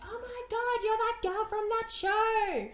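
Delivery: mock starstruck squeal; excited fan. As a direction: imitate a thrilled stranger spotting someone. Fan recognizing her, retold.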